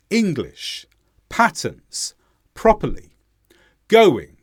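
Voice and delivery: "English, patterns, properly, going" each punched on the first syllable, then falling; word by word, hit ENG, PAT, PROP, GO; first-syllable stress. Shown as a demonstration. Teacher showing stressed syllables, "English, patterns, properly, going" front-stressed. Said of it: "how I stressed the first syllable".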